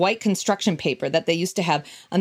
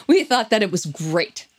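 ordinary delivery

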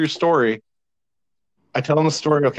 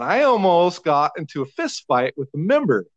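ordinary talk, nothing marked

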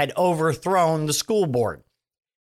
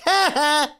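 high, mocking "ha ha" through a laugh; 12 semitones higher than usual. Mimicking the other side laughing off Biden, a high mocking laugh. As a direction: laugh at them like a smug rival. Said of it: "Mitch McConnell and Kevin McCarthy on the other side are going".

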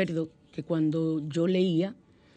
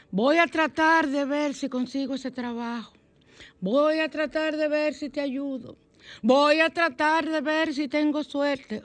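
higher, whiny imitation of someone never committing; higher, drawn-out mocking of a hesitant speaker; mock whiner; 6 semitones higher, 3 dB louder than usual. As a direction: play the doubter who won't really try.

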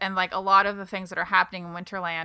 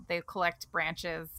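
normal talking voice

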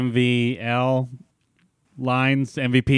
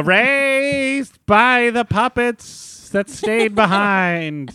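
slow high goofy voice; 9 semitones higher, much slower than usual. Acted.

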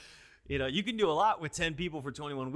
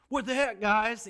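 high, loud mock rant; imitating a pastor yelling at the church; frustrated outburst; 8 semitones higher, 9 dB louder, slower than usual. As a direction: blow up at the congregation, hammed-up. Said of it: "got in front of the church and was like".

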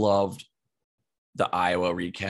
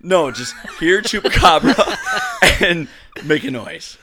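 laughing; 8 semitones higher, 9 dB louder than usual. Real, not acted. Explaining through laughter.

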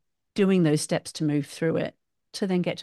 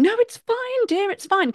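much higher, falsely sweet "everything's fine" voice; fake-calm mum voice; 13 semitones higher than usual. Mum covering her anger with her child, high put-on voice. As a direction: put on a high, strained mummy voice, holding it in. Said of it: "I've got this certain voice".

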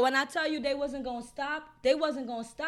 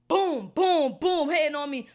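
loud retold blows; 2 semitones higher, 9 dB louder than usual. Recalling being beaten from behind, loud pounding booms. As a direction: hammer each boom like a blow landing.

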